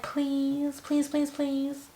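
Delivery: soft, begging please; mock sweet pleading, quiet. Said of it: "even if they're like".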